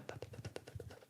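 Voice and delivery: breathy ta ta ta, very soft like toy smashing; unvoiced little smashing taps; whispered breaking noises. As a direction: whisper the sound of breaking the box. Acting out rebuilding the box.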